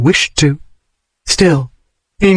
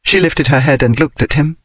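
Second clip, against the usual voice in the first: ordinary delivery, nothing marked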